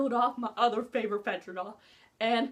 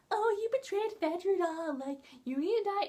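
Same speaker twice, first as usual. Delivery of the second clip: mock villain boy; 7 semitones higher than usual. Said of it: "that stupid Fedra boy ... was like".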